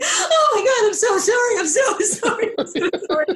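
high, giggling and breathless sorry; laughing apology. Retold or imitated. Castmate in the wings apologizing to her, high laughing sorry. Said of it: "Greg Edelman was in the wings going".

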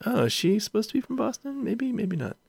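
high-pitched mock puzzlement; doubtful musing. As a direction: wonder it aloud in a light, high voice. Voicing doubt about actress's shaky accent.

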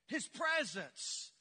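stretched slowly, word by word; slow drawn-out answer. Shown as a demonstration. Pastor drawing the answer from church, slowly. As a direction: let the answer land slowly. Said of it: "two of us said that".

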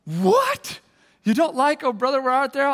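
shocked gasp. Retold or imitated. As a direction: imitate a scandalised crowd hammed up. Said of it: "First service gasped".

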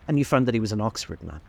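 usual speaking voice

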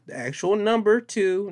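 number two said high and emphatic; stressing the actual number two. Shown as a demonstration. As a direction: insist on the digit two. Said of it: "not the number two".

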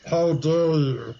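slow, lightly voiced stern demand; a hint of a cold professor. Snape confronting the students, slow mock sternness. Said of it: "demanded Professor Snape".

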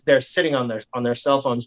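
usual speaking voice

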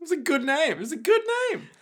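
squeaky-high protest, pitch leaping upward; high-pitched insistence. Real, not acted. Co-host defending a mocked name, high-pitched insistence.

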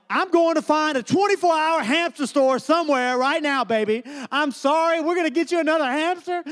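high-pitched, frantic tearful promise; mock crying. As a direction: plead like a dad blubbering along with his child, hammed up. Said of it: "And I'm crying, and she's crying".

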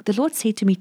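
ordinary talk, nothing marked

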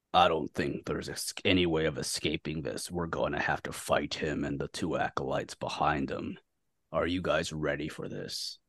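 very low, hushed character voice; grave leader. Acted. Taraki readies the companions for a fight, low and hushed. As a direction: drop deep and soft, grave before battle. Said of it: "Taraki looks at the rest of you".

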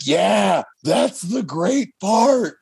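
high, slow, drawn-out gleeful reply; gleeful director. Retold or imitated. Director answering the Batmite question, high drawn-out glee. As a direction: savor it like a proud prankster. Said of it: "and I was just like".

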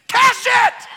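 loud, high burst of joy; excited shout. Retold or imitated. Evangelist told of a big check, shouting loud and high. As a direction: yell it triumphantly.

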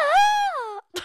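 high screaming laugh; shrieking laugh. Real, not acted. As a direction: burst into a shrill laugh.

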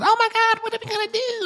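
very high panicked squeal; hammed-up high-pitched panic; mock freak-out. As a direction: play a whole crew in a panic. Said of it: "everyone's all freaking out".

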